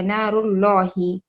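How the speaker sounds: slow नारुल्लाही with heavy dark L; thick L. Shown as a demonstration. Teacher applying the rule after raa, thick L in नारुल्लाही. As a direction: round the "laa" in नारुल्लाही towards "law". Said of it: "मोटा पढ़ा जाएगा".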